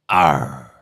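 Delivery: low, loud pirate growl on Arr. Pretend. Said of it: "do me pirate accent".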